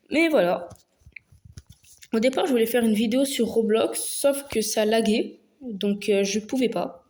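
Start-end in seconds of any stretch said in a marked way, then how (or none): none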